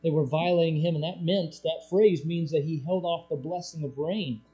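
ordinary talk

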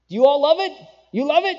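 high, loud, insistent questioning; pushy chef. Acted. Preacher playing a chef pushing cardboard on his guests, loud and insistent. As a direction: demand they say they love it, pressing hard.

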